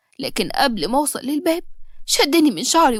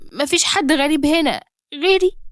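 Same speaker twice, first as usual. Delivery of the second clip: hurt disbelief; 3 semitones higher than usual. Acted. Girl echoing the father's cruel words, stunned. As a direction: throw his words back in shock. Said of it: "echoes father's words".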